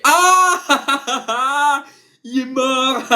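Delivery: slow, high cry breaking into laughter; laughing outburst. Retold or imitated. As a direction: blurt it out while cracking up. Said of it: "un mec qui fait".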